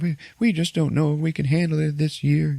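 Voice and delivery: high-pitched mock farmer couple fretting; worried client couple. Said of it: "they're talking about their problems".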